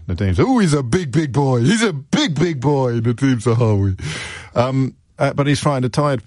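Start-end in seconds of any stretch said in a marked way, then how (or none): 0.4-4.0 mock impression. Mocking Zahawi's boast, far voice.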